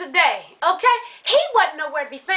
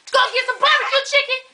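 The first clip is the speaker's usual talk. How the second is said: exasperated yell; 8 semitones higher, faster than usual. Real, not acted. Friend shouts in outrage that the deli was closed. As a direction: blow up at the unfairness of it.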